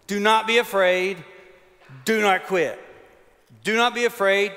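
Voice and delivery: loud emphatic refrain; preaching refrain. Acted. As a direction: hammer home the command.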